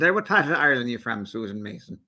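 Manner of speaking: put-on quick, playful Irish accent; a light mock Irish accent on the question. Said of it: "Now you got through doing the Irish accent".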